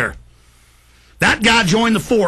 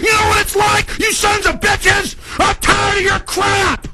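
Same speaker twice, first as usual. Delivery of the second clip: higher, yelling in outrage; furious shouting; 8 semitones higher than usual. Real, not acted. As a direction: scream it at them, furious.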